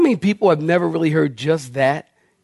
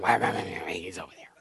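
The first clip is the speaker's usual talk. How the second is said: mean nurse mumble; 11 semitones lower, 14 dB quieter, faster than usual. Retold or imitated. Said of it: "And she's like".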